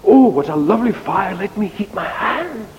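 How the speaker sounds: high, quick cry of delight; delighted admirers. Acted. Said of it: "people with cold hands come and say".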